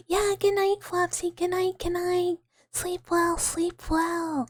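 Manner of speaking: high, cooing send-off; fond goodnight. Real, not acted. Streamer saying good night to Flopsy, high fond send-off. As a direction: coo it in a high, sing-song voice.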